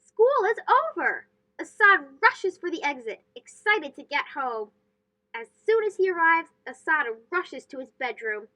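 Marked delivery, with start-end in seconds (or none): none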